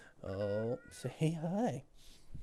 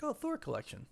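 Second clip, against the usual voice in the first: mock delight; 14 semitones higher than usual. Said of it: "and it's like".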